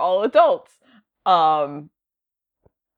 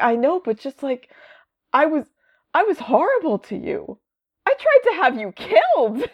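remorseful Jonah; 7 semitones higher than usual. Retold or imitated. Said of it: "He's like".

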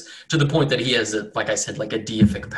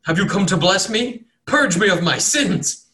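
higher, louder, hopeful begging; pleading cultist; 8 semitones higher, 5 dB louder than usual. Acted. Unhinged cultist begging the cleric to bless him. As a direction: beg the holy man for blessing.

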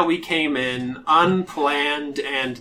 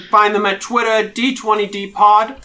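imperious British drow priestess; 5 semitones higher, 6 dB louder than usual. Pretend. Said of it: "how all of the Imperious Drow priestesses will speak".

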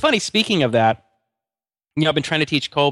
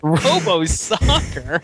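incredulous echo; 4 semitones higher, much slower than usual. Real, not acted.

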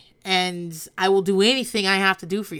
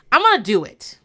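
raised, louder mock threat; 5 semitones higher, 8 dB louder than usual. Mock-threatening the family, loud. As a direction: declare it loudly, half joking.

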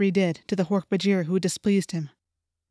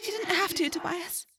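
high, very soft farewell; sad; 10 semitones higher, 8 dB quieter than usual. A narrator's reading. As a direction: answer tenderly in a high, fading voice. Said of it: "Rachel said sadly".

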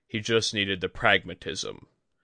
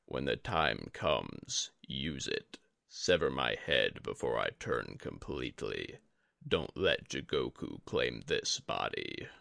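a dying sensei, calm and heavy; Yori the old master; 8 semitones lower, 7 dB quieter than usual. A narrator's reading. Yori's final request.

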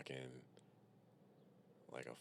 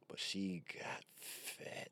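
whispered punchline; 4 semitones higher, 6 dB quieter, much slower than usual. Acted.

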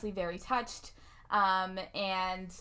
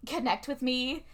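ordinary talk

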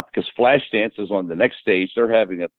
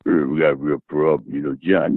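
low, lightly voiced tough-guy imitation; imitating Sly; 9 semitones lower than usual. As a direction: drop the voice for a light Rocky-style take. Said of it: "it's like Rocky meets Barbarino".

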